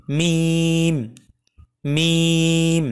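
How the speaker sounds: "মিম" with a very long, level ee, closing on the lips; letter demonstration. Shown as a demonstration. Teacher introducing the first lip letter, "মিম" slowly drawn out. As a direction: hold "মিম" out slowly on one steady note. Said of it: "প্রথমে মিম অক্ষরটি শিখব".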